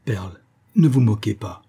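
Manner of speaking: usual speaking voice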